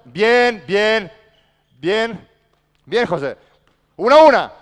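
high, loud cheers; excited praise. Real, not acted. Coach praising José's play, loud excited cheers.

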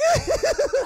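loud, high maniacal cackle; evil demon cackle. Acted. Demon bursts out of Itadori with a high evil cackle. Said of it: "the Mark Hamill demon goes like".

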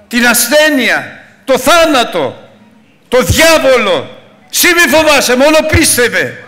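high, loud shouted sermon; preaching at full force. Acted. Pastor shouting God's promise to the congregation, high and loud. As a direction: thunder it out to the church.

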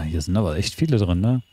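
normal talking voice

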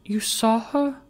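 high, rising astonished question; exclaiming in shock. Acted. Meg exclaiming to Richard in astonished disbelief. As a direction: gasp it in disbelief. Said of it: "exclaimed Meg".

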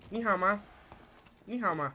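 high, loud singsong "Ni hao ma"; mock Chinese greeting. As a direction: shout the greeting in a hammed-up accent.

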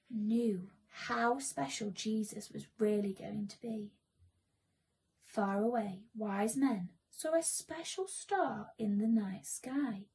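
ordinary delivery, nothing marked

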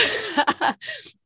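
light laugh. Real, not acted. Laughing mid-thought.

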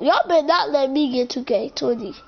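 ordinary delivery

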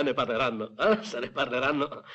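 usual speaking voice

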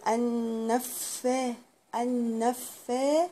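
النفا with the f held as two letters; doubled f. Shown as a demonstration. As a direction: lean on the doubled f. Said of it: "معناها ان هي حرفين". (تشديد الفاء في النفا)

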